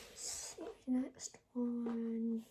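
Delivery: soft unvoiced whisper. Real, not acted. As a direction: whisper to yourself while choosing. Muttering while picking next.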